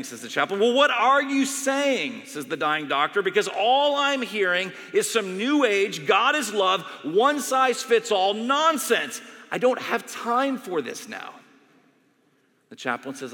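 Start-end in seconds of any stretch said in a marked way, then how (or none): 0.6-2.2 high, loud and slow; exasperated demand. Retold or imitated. Dying doctor cutting off the chaplain, exasperated. 3.2-11.3 loud, slow, contemptuous rant; scornful outburst. Retold or imitated. Dying doctor dismissing the chaplain's answer, scornful.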